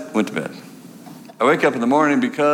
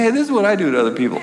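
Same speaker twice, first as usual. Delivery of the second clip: quick, higher chuckling aside; laughing realization; 6 semitones higher, much faster than usual. Retold or imitated. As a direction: laugh at the irony. Inner thought, amused.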